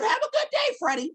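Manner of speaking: a hint of the loving wife writing a note; wife's sweet note. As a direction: read her note in a high sweet voice. Wife's note read aloud.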